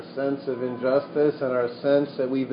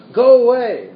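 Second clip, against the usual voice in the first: louder and higher, a sharp outraged command; shouted; 8 semitones higher, 8 dB louder than usual. Acted. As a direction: throw it out like an angry attendant.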